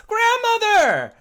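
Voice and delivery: high, loud shout of Grandmother; calling out. Acted. Voice from down the stairs calling out, loud shout. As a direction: yell it from down the stairs.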